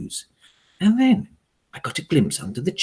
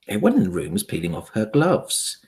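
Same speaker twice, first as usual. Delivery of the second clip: ordinary delivery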